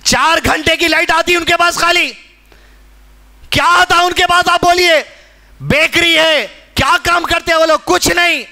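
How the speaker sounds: high, forceful sermon delivery; impassioned preaching. Real, not acted. Preacher challenging the congregation about Gaza, impassioned.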